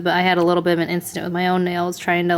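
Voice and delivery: normal talking voice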